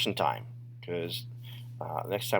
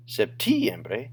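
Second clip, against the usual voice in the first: septiembre with TI punched higher; wrong-syllable stress; 5 semitones higher, 4 dB louder than usual. Shown as a demonstration. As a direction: push the TI in septiembre up and hard. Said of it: "your voice always goes up".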